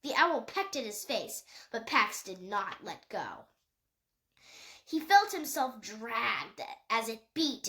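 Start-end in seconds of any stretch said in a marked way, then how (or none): none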